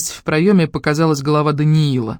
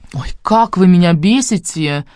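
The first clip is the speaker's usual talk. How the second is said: slow exaggerated mock annoyance; fake angry; 3 semitones higher, 4 dB louder, slower than usual. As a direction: pretend to be irritated. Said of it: "нарочито сердито бросил Даниил".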